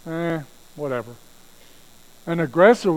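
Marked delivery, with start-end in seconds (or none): 0.1-1.1 mock shrug, trailing off on "whatever"; bored indifference. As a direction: toss it off like you don't care. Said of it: "A passive listener says".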